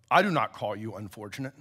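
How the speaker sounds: a low, measured large voice. A narrator's reading. Great lion answering the boy beside him, low large voice. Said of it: "The large voice said beside him".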